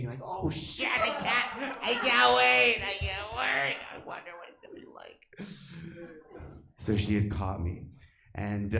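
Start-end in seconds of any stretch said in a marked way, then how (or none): 0.2-3.8 high, drawn-out excited squeal; giddy anticipation. Acted. Kid spotting a video title to download, giddy squeal. 3.9-5.5 high, hushed, quick aside; excited whisper. Acted. Kid waiting for a download, excited whisper. Acts secret anticipation while downloading.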